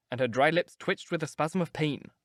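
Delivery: ordinary delivery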